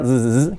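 "z, z, z" as short buzzes; voiced Z sound. Shown as a demonstration. Teacher isolating the first sound, buzzing Z. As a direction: buzz each "z" like English zoo. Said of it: "el sonido Z primero".

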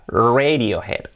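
"Radiohead" as REY-dio-hed; English-style RAY-dio-head; English pronunciation. Shown as a demonstration. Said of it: "se dice Radiohead".

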